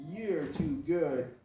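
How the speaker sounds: high, loud, stretched-out praise; gushing dog praise. Real, not acted. Trainer gushing over her pup, high and slow. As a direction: croon it slowly to the dog.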